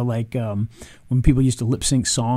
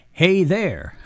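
a light mock of the avatar's stiff greeting, slightly higher; mimicking the AI guy; 5 semitones higher than usual. Vlogger mocks the AI avatar's greeting for viewers, light mimicry. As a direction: parrot the odd AI spokesman with a laugh. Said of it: "this one here, this guy".

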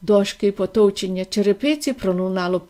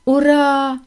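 drawn-out, higher hooray; joyful cry; 4 semitones higher than usual. A narrator's reading. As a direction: hold the cheer on a raised note. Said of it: "кричав дідунь Джо". (протяжний радісний вигук)

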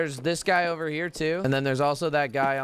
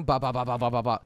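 rapid lower "ba" burst; imitated gunfire; 4 semitones lower, faster than usual. Streamer showing teammates how the fight went, mouthed gunfire. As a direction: mouth out machine-gun fire.

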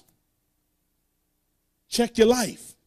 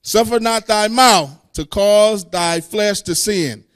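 preached scripture; 2 semitones higher, 8 dB louder, slower than usual. Real, not acted. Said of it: "Verse six again".

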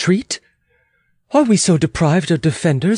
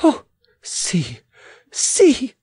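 gasped words leaping high; excited cry; 12 semitones higher, much slower than usual. Acted. Queen at the window watching the fight, crying out. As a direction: cry out, the last word shooting up. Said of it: "she cried with flaring eyes".

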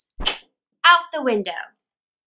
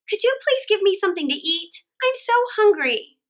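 put-on pleading boy; 7 semitones higher than usual. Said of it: "Jack said".

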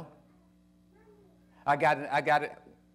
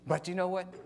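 ordinary talk

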